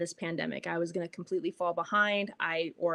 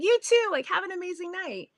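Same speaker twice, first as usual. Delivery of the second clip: much higher, sunny farewell; very high, chirpy cheerful sign-off; beaming goodbye; 10 semitones higher than usual. Retold or imitated. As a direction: send him off glowing.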